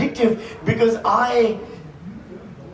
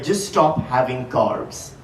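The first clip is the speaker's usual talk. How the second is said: deadpan doctor; 9 semitones lower, 5 dB quieter, slower than usual. Retold or imitated. Doctor quoted in a story.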